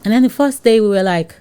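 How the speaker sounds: usual speaking voice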